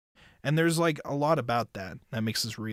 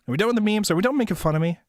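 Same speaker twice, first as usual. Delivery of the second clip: higher, louder fed-up question; exasperated plea; 7 semitones higher, 6 dB louder, much faster than usual. Real, not acted. Streamer begging chat to stop the memes, loud exasperated plea. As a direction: demand they stop teasing.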